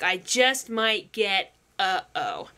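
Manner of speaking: slow, drawn out to a low "oh"; dawning realization. Acted. Quoting the boy as it dawns on him, slowly dawning dread. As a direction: let it sink in word by word. Repeats Ash's dawning line from song.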